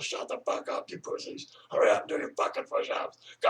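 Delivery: retold drill order in a hoarse whisper; harsh hoarse whisper. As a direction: rasp the orders without voice. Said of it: "I was like".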